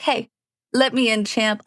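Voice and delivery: usual speaking voice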